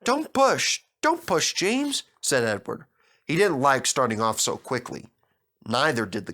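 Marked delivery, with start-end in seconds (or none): 0.0-2.0 put-on alarmed old engine; protesting. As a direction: tell him to stop pushing. Edward protests being pushed.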